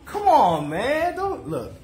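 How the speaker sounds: high, loud, excited protest; playful excitement. Real, not acted. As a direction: get worked up about the dance move. Teasing viewers about his dance.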